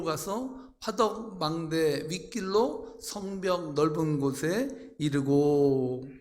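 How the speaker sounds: slow, measured congregational reading; scripture reading. Real, not acted. Pastor reading verses together with congregation, solemn recitation. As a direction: read it out formally, drawing out phrase endings.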